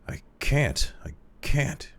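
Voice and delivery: high, whispered moan. A narrator's reading. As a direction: moan it in despair. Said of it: "he moaned".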